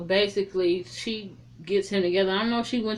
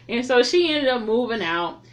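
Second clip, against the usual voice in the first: normal talking voice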